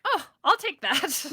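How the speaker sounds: high, slow and drawn out, greedy; snatch the offer like a pleased spirit; eager demon. Retold or imitated. Powerful spirit answering the tarot invitation, gleefully.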